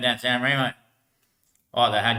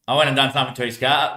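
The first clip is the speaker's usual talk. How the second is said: ordinary talk, nothing marked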